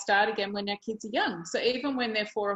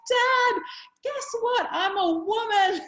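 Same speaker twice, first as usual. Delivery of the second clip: high, loud, bursting with pride; proud excited girl; 12 semitones higher, 5 dB louder, slower than usual. Retold or imitated. Daughter telling her dad the news, proud and thrilled. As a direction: shout the big news down the phone.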